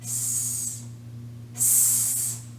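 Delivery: long voiceless "sss" for "S"; isolated S sound. Shown as a demonstration. Teacher pointing at the last letter, sustained "sss" for "S". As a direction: sustain "S" as pure air, not the letter name. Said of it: "Try to say the sound".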